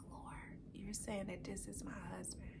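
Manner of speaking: very soft, whispered question to God; hushed prayer. Retold or imitated. Woman asking God about her husband, hushed prayer. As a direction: whisper it privately, as in a retold prayer.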